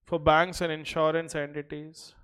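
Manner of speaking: slow, even dictation pace. Acted. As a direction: read it out for notes. Dictates notes to class.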